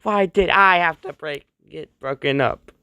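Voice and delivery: high, loud, hammed-up heartbreak; exaggerated crying protest, easing toward the end; mock wailing. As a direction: wail it like a melodramatic tearful cartoon. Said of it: "And he's crying".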